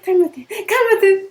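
imitating a friend begging calm. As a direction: drag out a pleading calm-down. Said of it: "Y el otro así".

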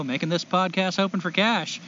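normal talking voice

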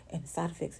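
low, very soft rushed mumble; fast disclaimer. Retold or imitated. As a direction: imitate an advert's hurried side-effect small print. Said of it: "And they say it fast".

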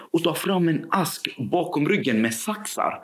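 ordinary delivery

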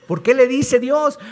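usual speaking voice

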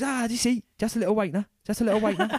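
high-pitched, taunting tough-guy impression; playful jeering, pitched well up; mock goading bruiser. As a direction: imitate a cockney bruiser needling his victim. Bruiser goading man he hit.

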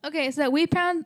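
ordinary delivery

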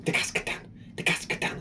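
whispered rhythmic chant like wheels on tracks; soft unvoiced chugging chant; whispered train chant. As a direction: breathe it out like a train on rails. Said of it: "was just sat in the studio going".

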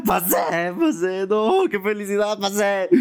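high, jubilant cries; celebración eufórica. Retold or imitated. Telling mom and sister he passed. (gritos agudos de felicidad)

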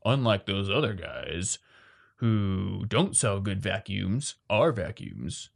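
low, slow mock sales-pitch voice; putting rivals down. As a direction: drag it out low and slow, like a snide comparison ad. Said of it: "you don't need to be like".

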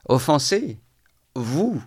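high, incredulous questions; scornful disbelief. A narrator's reading. Tverkov echoing an apology from his inferior, scornful disbelief. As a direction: throw the word back, incredulous.

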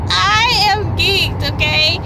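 high, drawn-out declaration; slow, high-pitched geeking out; emphatic excitement. Real, not acted.

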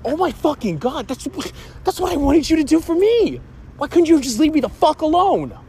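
high, loud furious shriek, pitch way up; shrieking fury at the mother; screaming at mom. Retold or imitated. Yelling at mom to leave him alone, high furious shriek. Mock quarrel quote at mom.

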